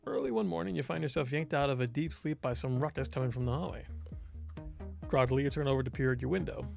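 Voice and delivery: ordinary delivery, nothing marked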